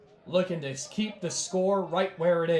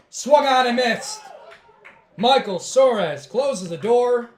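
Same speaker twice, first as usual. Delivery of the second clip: higher, louder excited strikeout call; 7 semitones higher, 10 dB louder, slower than usual. Real, not acted. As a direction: shout the final out, thrilled. Game-ending strikeout call.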